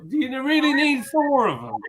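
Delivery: high, mock apologetic voice; imitating the thief. Retelling the caught beer mat thief, lightly voiced. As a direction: squeak out a sheepish sorry. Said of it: "And he was like".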